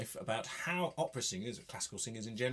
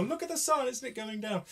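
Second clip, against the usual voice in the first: high, marvelling wonder; 13 semitones higher than usual. Shown as a demonstration. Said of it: "Give it a".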